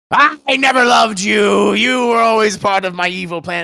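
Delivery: mock villain. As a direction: ham up an evil mastermind's cruel reveal. Imagined villain's cruel last words.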